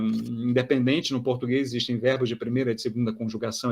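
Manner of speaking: usual speaking voice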